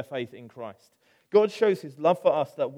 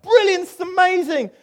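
much higher, louder, bright cheer; delighted gush; 10 dB louder than usual. Pretend. Church members welcoming newcomers to Bognor, mock delighted gush. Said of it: "we'd be like".

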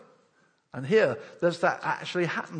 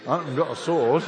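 laughing; 8 dB louder than usual. Acted. Voicing the swordless friend.

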